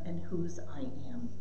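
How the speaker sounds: hushed, reverent whisper. Real, not acted. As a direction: let the words fall to a whisper. Profound point, voice drops.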